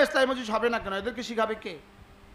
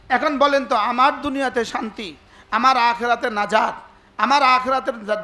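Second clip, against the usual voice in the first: usual speaking voice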